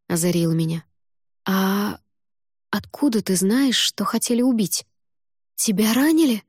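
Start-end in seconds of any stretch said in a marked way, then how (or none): 1.4-6.4 rising in alarm, higher and higher; horrified exclaim. Acted. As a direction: blurt it out in sudden fear. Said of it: "В ужасе воскликнула я".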